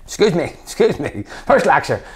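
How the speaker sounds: calling out the lecturer across the hall; indignant heckle. Retold or imitated.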